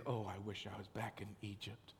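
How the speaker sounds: soft, low mock whine; whining complaint. Preacher mocking the Israelites longing for Egypt, soft whine. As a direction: sigh it like a grumbler. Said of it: "you were very quick to say".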